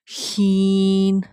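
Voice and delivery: شین with a hissing sh, held long; drawn-out, hushing شین; model letter pronunciation. Shown as a demonstration. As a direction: stretch شین out slowly. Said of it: "اسی طرح".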